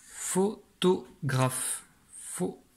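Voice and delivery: very slow word recap in separate syllables. Shown as a demonstration. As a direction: repeat the word in widely spaced pieces. Mot relu par syllabes. (mot répété très lentement, syllabe par syllabe)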